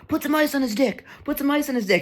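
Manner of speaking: high-pitched frantic imitation; high mock-panicked insistence; panicking friend. Acts out the panicking friend's demand.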